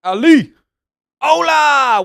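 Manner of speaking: loud, high shout-out to a new viewer; big hype welcome; excited greeting. Real, not acted. Greeting chat member excitedly.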